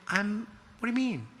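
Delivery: high-pitched, bewildered stammer; baffled disbelief. Retold or imitated. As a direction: play the befuddled boyfriend, voice jumping up. Baffled reply as girlfriend leaves.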